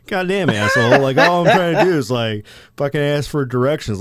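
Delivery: exasperated mock outburst, loud and high; indignant retold complaint. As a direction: protest like someone just snubbed. Retold grumbling at slammed door.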